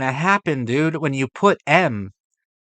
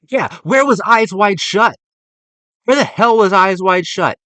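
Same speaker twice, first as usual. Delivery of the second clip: louder, higher, outraged question; indignant demand; 5 semitones higher, 7 dB louder than usual. Real, not acted. Ranting at the list maker, loud and outraged. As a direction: demand an answer, incensed.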